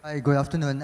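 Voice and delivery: usual speaking voice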